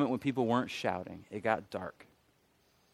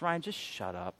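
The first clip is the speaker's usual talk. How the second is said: quiet divine rebuke; 2 semitones lower, 5 dB quieter than usual. Retold or imitated. God hushing a rambling man, quiet rebuke. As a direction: cut in gently, well under your usual volume. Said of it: "God didn't speak in an audible voice".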